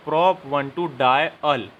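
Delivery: slow dictation. Shown as a demonstration. Teacher dictating compound name.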